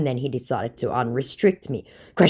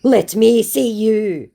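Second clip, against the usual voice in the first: slow, loud, higher insistence; mock demand; 6 semitones higher, 7 dB louder, slower than usual. Scammer repeating his demand to the host, mock insistent. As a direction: press each word as a put-on order. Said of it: "he demands".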